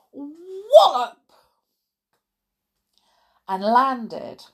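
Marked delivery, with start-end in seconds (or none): 0.1-1.3 high, loud swooping thump word; wallop effect. A narrator's reading. Both hit the wall, loud wallop. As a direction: punch it out like a crash. Narrator voices crash.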